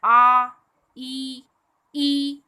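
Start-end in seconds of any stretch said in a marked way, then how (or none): 0.0-0.5 आ held out loud and open; long vowel demo. Shown as a demonstration. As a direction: call out the vowel आ. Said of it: "लेकिन जब हम अ".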